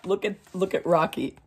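ordinary delivery

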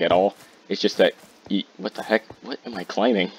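normal talking voice

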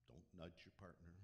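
ordinary talk

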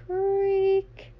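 high creak, voice drawn out; creaky door sound. Acted. Imitating a creak.

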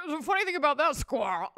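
twitchy alien in a human body. Retold or imitated. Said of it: "And he's like".

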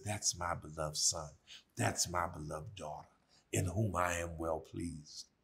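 a quiet inner voice of approval; little voice. Retold or imitated. God's little voice to a beloved child, soft and low. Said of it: "That little voice that says".

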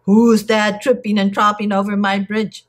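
put-on troll voice, projected; shouting troll. A narrator's reading. Troll challenges great big goat on his bridge, shouting. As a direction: challenge the trespasser as an ugly troll.

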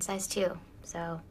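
ordinary talk

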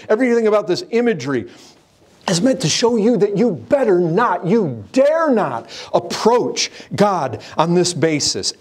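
full-force pulpit preaching; fiery preaching. Acted. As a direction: preach it high and hard to the congregation.

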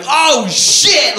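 high, loud panicked yell; drawn-out dismayed holler; alarmed shout. Acted. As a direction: cry out as if jolted from bed. Acts kids jumping out of bed.

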